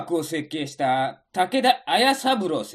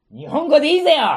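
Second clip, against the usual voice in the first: Tosa dialect; 8 semitones higher, 7 dB louder, much faster than usual. Acted.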